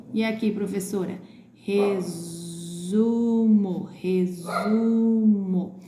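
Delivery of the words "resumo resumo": resumo very slowly, long buzzed Z and long u; stretched Z sound. Shown as a demonstration. Teacher demonstrating for pupils, drawn-out resumo with Z. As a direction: hold the Z in resumo. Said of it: "É o S com som de Z também".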